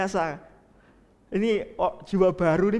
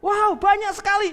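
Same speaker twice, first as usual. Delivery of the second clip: surprised; 11 semitones higher, 5 dB louder than usual. Acted. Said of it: "Wah, kakaknya kaget".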